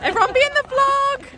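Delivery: very high sing-song call; excited call. Real, not acted. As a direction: call everyone in cheerfully. Calling to friends.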